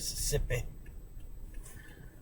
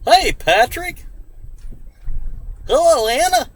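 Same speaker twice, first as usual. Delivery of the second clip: put-on playful Mississippi accent, higher and a bit louder; 12 semitones higher, 4 dB louder than usual. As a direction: greet the chat in a hammed-up Mississippi accent. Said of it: "so I thought I'd use a Mississippi accent".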